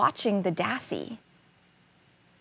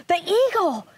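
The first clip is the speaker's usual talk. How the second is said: higher-pitched cry of alarm; 8 semitones higher than usual. A narrator's reading. Little dassy spotting the eagle's shadow, alarmed cry. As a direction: cry out a sudden warning. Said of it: "she cried".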